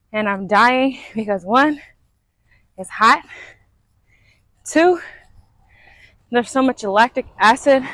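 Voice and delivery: breathless while jogging; out of breath. Real, not acted. Talking while running.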